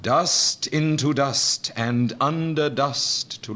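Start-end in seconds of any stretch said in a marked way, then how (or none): none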